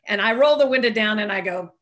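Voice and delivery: ordinary talk, nothing marked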